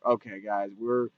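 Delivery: low, soft, weary aside; unimpressed aside. Retold or imitated. Viewer addressing the film's characters, quietly unimpressed. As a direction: say it quietly under the talk. Acts out reaction to the scene.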